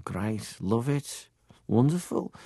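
imitated Liverpool accent, softly rising; short bursts of imitation Liverpool speech. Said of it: "my dad had a Liverpool accent".

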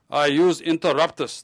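put-on sheepish line in a heavy East European accent. Patient speaking up for the first time, heavy East European accent. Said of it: "in a heavy East European accent".

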